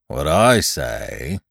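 low, slow donkey voice; Eeyore, gloomy. Acted. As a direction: grumble low and very slowly. Eeyore gloomily objects in story.

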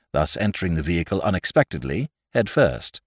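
ordinary delivery, nothing marked

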